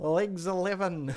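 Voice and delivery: slow, high drawn-out bingo call; bingo caller. Pretend. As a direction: stretch it out like a bingo hall caller. Calling bingo after streaming bingo joke.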